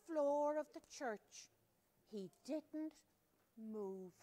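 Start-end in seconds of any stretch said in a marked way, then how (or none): none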